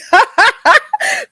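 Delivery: bursting into a high laugh; tickled by her own joke, high laughter. Real, not acted.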